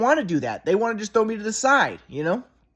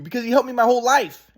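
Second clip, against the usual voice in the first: normal talking voice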